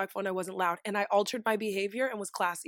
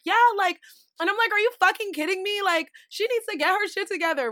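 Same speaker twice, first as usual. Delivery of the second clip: high, raised voice of table gossip; loud, excited chatter; 8 semitones higher, 3 dB louder than usual. Retold or imitated. Friend gossiping at happy hour, loud and animated. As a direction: re-enact being a little too loud, pitched up. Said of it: "we were a little loud".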